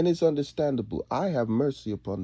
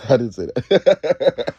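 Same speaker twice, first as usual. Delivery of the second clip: slow, louder, laughing through the words; laughing denial; 8 dB louder, slower than usual. Real, not acted. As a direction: protest while cracking up.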